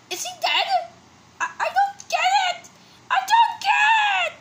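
very high-pitched frantic questioning; panicked confusion. Acted. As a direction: build into a baffled high yell. Acted panic over a character's fate.

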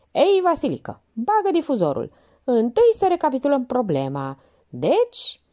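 a junior officer working up courage; lieutenant starting his report to Minerva; lieutenant, nervous. Acted.

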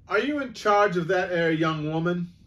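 high, loud pompous constable; officious policeman. Acted. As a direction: demand attention pompously. Policeman questions.

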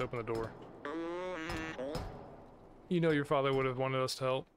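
normal talking voice

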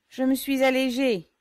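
cheerful shout. A narrator's reading. Yégor calls out from the doorway, loud and jolly. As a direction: announce it with a merry boom.

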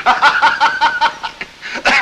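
loud high guffaw; high mocking laugh. Colonel laughing at Beckmann's tale, loud mocking guffaw. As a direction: laugh him off as a clown.

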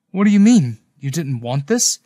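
taken aback, rising at the end; caught off guard. A narrator's reading. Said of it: "That caught me off guard".

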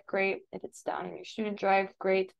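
normal talking voice

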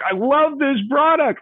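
very high, drawn-out mock gushing; elated. Customer giving a five star review, elated. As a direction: act out an over-the-top delighted customer. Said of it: "Maybe you're elated".

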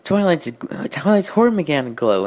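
ordinary delivery

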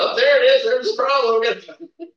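loud, high exclamation, drawn out at the end; hammed-up eureka call; mock discovery. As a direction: act out suddenly spotting the fault. Acts out friend spotting the problem.

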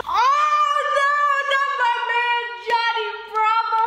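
mock wail. Mock grief over cartoon.

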